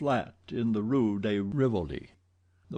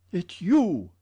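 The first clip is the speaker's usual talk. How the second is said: high, loud feverish cry; 8 semitones higher, 6 dB louder than usual. A narrator's reading. Raoul crying out on recognizing the stranger. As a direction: blurt it out in a fever. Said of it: "he cried in a feverish voice".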